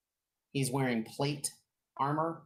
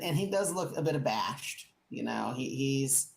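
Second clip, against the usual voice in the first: ordinary talk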